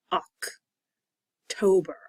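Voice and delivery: OCTOBER very slow, oc... tober; drawn-out, split October showing both vowels; two O sounds. Shown as a demonstration. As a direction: pause after the short-O OC, then TOBER. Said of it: "both O's make different sounds".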